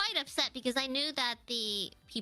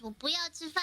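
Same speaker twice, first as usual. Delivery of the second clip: "我不要吃饭" fast and run together, a bit higher; quick Chinese phrase; 5 semitones higher, much faster than usual. Shown as a demonstration. Guest showing a phrase to the host, quick "我不要吃饭". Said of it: "if I say I don't wanna eat".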